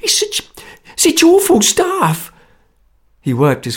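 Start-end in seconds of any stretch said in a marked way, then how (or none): 0.0-2.3 high, pleading, stretched "awful"; nervous plea. A narrator's reading. Frightened man begs them not to bang it down, high and pleading. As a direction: beg them to be careful, trembling.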